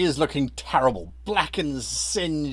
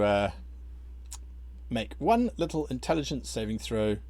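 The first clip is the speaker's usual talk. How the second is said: ordinary talk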